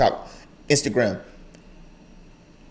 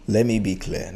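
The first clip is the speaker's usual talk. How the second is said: lowered, deliberate father warning; dad's low warning voice; 4 semitones lower, 6 dB quieter than usual. Retold or imitated. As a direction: drop the voice like a dad who means business. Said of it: "They, they lower their voice and clear their throat".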